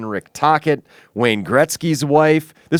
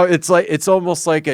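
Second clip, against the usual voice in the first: ordinary talk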